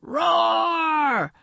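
long, high monster roar; drawn-out storybook beast roar. A narrator's reading. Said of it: "said the sea monster".

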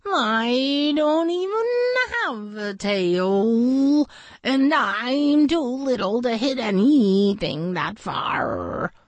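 high, slow little voice; tiny insect voice. A narrator's reading. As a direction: speak like a tiny bug. Flitter speaking.

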